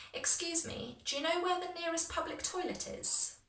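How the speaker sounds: high-pitched, quick model question with merged words; quite fast. Shown as a demonstration. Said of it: "I know I'm going quite fast".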